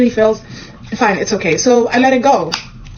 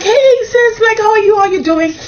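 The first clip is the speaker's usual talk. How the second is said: overly sweet greeting; 12 semitones higher than usual. Retold or imitated. Greeting Naomi on phone.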